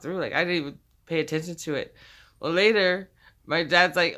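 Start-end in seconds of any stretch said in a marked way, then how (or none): none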